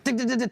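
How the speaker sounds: high buzzing mock taser sound; taser noise. Guy tasing him, mimicked as a high zap. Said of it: "the guy's like".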